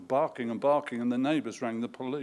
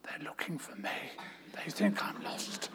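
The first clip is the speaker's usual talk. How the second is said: tiny whispered yes; 9 dB quieter than usual. A narrator's reading. Hiding child whispering to the caller. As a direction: barely breathe the word.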